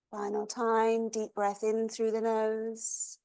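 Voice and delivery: soft, even voice with long pauses; guided meditation. Acted. Yoga teacher leads class through long exhales, guided meditation.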